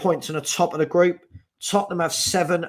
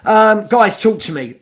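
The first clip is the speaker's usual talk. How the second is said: raised call to the viewers; 5 semitones higher, 9 dB louder than usual. Real, not acted. Host calling out loudly to his viewers. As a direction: call out to get their attention.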